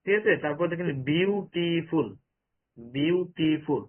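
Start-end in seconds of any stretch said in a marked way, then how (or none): 1.1-2.1 "বিউটিফুল" slowly split as বিউ, টি, ফুল; slow syllable-by-syllable "বিউটিফুল"; three syllables. Shown as a demonstration. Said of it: "তিনবারে বলতেছি". 2.8-3.8 "বিউটিফুল" slowly broken into বিউ, টি, ফুল; very slow, syllable-by-syllable "বিউটিফুল"; three syllables. Shown as a demonstration. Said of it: "তিনবারে বলতেছি".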